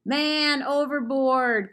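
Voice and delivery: loud, slow, high alarm call. Acted. Billy Bob calling out as the troll goes under, loud and slow. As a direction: sound the alarm across the water. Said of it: "called out Billy Bob".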